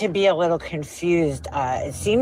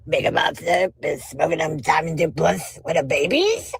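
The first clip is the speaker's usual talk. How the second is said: repeats the mocking line in a put-on lisping voice; mocking lisp imitation. YouTuber repeating the clip that mocks her lisp, put-on lisping mockery. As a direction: parrot the impression she did of you. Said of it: "the fucking imitation of Queen Bee's voice".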